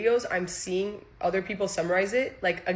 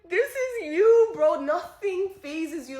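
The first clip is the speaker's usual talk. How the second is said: high, drawn-out exasperation; retold crazed rant; 10 semitones higher, slower than usual. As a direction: stretch the words in mock hysterical reproach. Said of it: "I'm literally going crazy".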